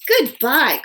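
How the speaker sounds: loud, high goodbye; calling out. A narrator's reading. Owl calling after departing Winter, loud. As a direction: call it after him. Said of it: "called Owl".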